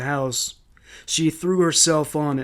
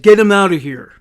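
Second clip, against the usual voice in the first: loud, higher, forceful command; sharp raised-voice command; barked order; 5 semitones higher, 11 dB louder than usual. A narrator's reading. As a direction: shout it as a police order. Officer orders son dragged out.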